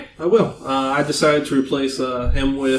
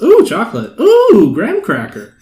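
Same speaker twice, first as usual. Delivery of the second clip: high, loud mock-excited oohs; hammed-up squeal of sudden interest; easily distracted delight; 10 semitones higher, 6 dB louder than usual. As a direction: act out getting sidetracked by treats. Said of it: "and then I'm like".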